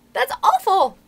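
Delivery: high, loud dismay; appalled outburst. Acted. Reader appalled at the chapter's end, dismayed cry. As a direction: exclaim in high-pitched shock. Reader reacts to Wendy being shot.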